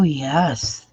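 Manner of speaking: normal talking voice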